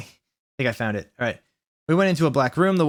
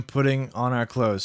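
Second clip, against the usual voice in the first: usual speaking voice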